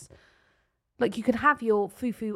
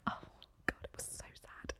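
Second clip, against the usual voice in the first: whisper; 18 dB quieter than usual. Real, not acted. Mourning the lost tooth.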